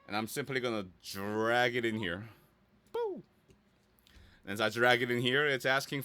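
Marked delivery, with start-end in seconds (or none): 2.9-3.2 squeaked way up high in playful triumph; a high, playful "Boo!". Real, not acted. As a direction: pop it out like a ta-da. Drops the logo into the page.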